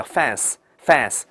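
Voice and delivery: "fans" ending in a voiceless s, like "fanss"; 错误读法. Shown as a demonstration. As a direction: wrongly hiss the end of "fans" as a short s. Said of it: "fans不能错啊". (把"fans"词尾错读成清音s)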